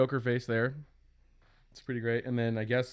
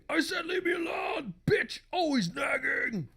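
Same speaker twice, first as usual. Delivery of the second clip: [name] voice; 13 semitones higher than usual. Acted. Husband tells nagging wife off, in [name] voice. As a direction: act an angry husband like a metal frontman. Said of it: "he just talks in [name] voice".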